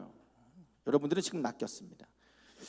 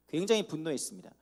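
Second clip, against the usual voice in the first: ordinary delivery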